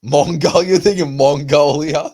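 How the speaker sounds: high, loud, slow disbelief; incredulous. Real, not acted. Mocking friend who confused Angola with Mongolia, incredulous. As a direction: tease him for mixing up the country.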